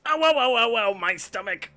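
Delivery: loud, high mock pain cries; exaggerated pained yelp. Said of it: "to quote you".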